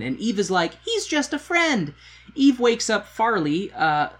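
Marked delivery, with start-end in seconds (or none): 0.8-1.9 high, slow, airy mock-girly voice; mock Eve. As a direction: play Eve dismissing him, pitched way up. Eve brushing off Farley.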